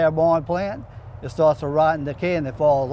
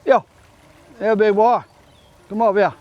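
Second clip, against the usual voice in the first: calling out; 5 semitones higher, 6 dB louder than usual. Real, not acted. Calling a gator over.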